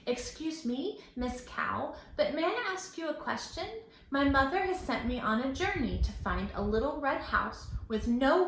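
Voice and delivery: put-on a boy politely asking the cow; polite little boy. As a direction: ask sweetly like a small boy.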